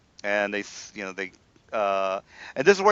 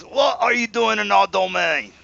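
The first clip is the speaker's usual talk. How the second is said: pitched-up British guard, demanding; British accent again; 6 semitones higher than usual. Acted. Game master redoes the guard's challenge in British accent. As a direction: ask it in a stagey British accent. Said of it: "All right, says".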